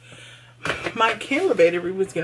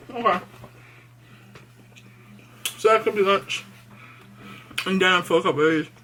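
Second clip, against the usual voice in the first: mouth full. Real, not acted. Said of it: "Excuse me for talking with my mouth full".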